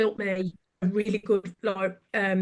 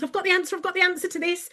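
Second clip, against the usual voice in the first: high, keen little fairy voice; bright-idea fairy, pitched up; eager willing fairy; 8 semitones higher, much faster than usual. Acted. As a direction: jump in high like a know-it-all helper. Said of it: "the willing fairy comes to the front".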